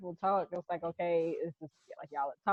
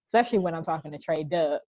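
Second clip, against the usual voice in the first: normal talking voice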